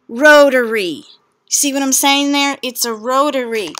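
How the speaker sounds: loud, delighted show-and-tell; proud announcement. Real, not acted. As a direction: announce it like a prize. Proudly showing off her phone.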